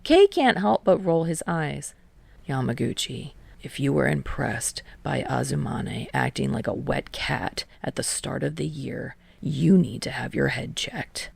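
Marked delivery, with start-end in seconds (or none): 2.5-11.3 low, softer deadpan tease ending in a whisper; dry teasing, lower voice. A narrator's reading. Kei teasing Tadashi about Azumane, low and dry. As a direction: tease him dryly, quiet.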